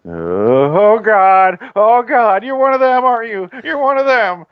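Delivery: put-on high, loud whiny American accent, voice breaking up; super American voice. Friend imitating Hans faking an American accent in panic. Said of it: "He does this, like, super American voice".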